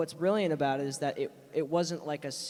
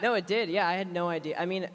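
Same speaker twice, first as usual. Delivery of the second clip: laughing; 5 semitones higher, 5 dB louder than usual. Real, not acted. Laughing at the joke.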